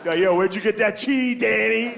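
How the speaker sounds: put-on teen calling out. Kid calling out across dinner table.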